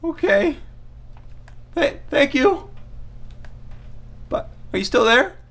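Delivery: very high, servile squeak; obey in a tiny submissive voice; meek underling. Acted. Diner answering bully Chad by phone, squeaky and submissive.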